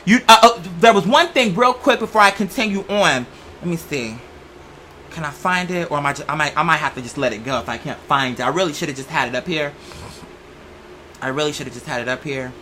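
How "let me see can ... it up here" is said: low, soft muttering while searching; half to yourself, hunting for the notes; muttering to herself. Real, not acted.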